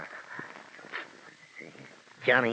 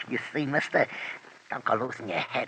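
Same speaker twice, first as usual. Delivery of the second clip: ordinary delivery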